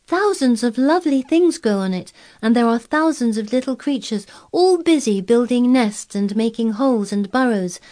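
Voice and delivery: high, loud delighted telling; enthusiastic. A narrator's reading. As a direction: gush about the moor. Girl enthuses about moor.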